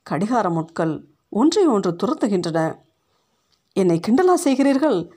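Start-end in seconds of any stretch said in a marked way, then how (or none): 3.7-5.1 higher, quick indignant question; mildly raised voice, pitched up and hurried; restrained narrated yell. A narrator's reading. Teacher accusing her class of mockery, a restrained yell. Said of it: "உரத்த குரலில் கத்தினாள்".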